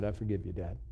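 soft, low reply of a son; retold forgiveness. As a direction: quietly let Dad off the hook. Son's retold quiet forgiveness.

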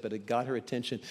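normal talking voice

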